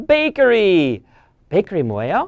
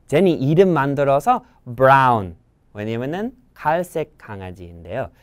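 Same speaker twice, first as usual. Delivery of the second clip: ordinary delivery, nothing marked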